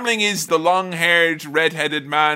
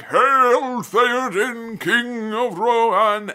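slow, drawn-out booming hail, higher; grand wizard's hail; 7 semitones higher, much slower than usual. Retold or imitated. Gandalf hailing King Theoden, slow grand booming call. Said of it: "He's like".

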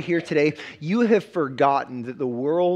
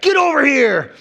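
a shouted game fighter's battle cry, much higher and louder; Mortal Kombat shout; 9 semitones higher, 8 dB louder than usual. Retold or imitated. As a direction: yank them over with a fighter's war cry. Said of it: "kind of aggressive and kind of intense".

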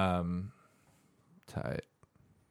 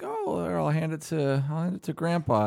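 high-pitched grandma voice; 7 semitones higher than usual. Retold or imitated. Grandma handing the phone to grandpa, high put-on voice. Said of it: "my grandma will be like".

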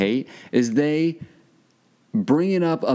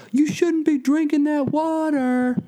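much higher, slow, drawn-out warning; mocking the stranger's voice; slower than usual. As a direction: play the nosy stranger with a high, slow, preachy voice. Imitating the stranger on the street.

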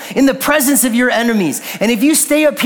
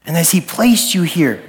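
lower, unhurried invitation to prayer; reflective altar call; 4 semitones lower than usual. Real, not acted. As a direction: gently lead them into reflection, leaving long pauses. Quiet closing reflection, eyes closed.

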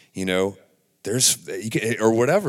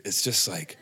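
ordinary delivery

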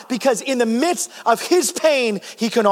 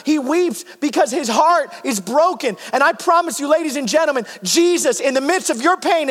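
rising pastor's preaching, higher and building; impassioned preaching; 6 semitones higher than usual. Acted. Pastor to congregation, preaching with building passion. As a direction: lift the congregation with each repeated line.

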